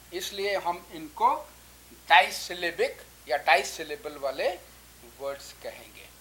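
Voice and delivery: ordinary talk, nothing marked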